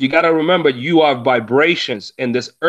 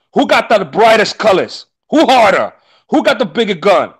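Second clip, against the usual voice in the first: raised, louder mock macho challenge; mock tough boasting; 6 semitones higher, 5 dB louder than usual. Mocking street rivals who compete over toughness, raised and louder. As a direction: throw out each boast like a street rival sizing someone up.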